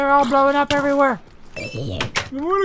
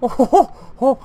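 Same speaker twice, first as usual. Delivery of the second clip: evil villain laugh; 3 semitones higher, 3 dB louder than usual. Acted.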